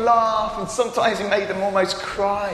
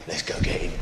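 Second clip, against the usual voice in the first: whispered plotting; mock whisper; 5 dB quieter than usual. Pretend fishermen hunting a man, whispering. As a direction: hiss it like sneaking up with a net. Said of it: "get a big net and go".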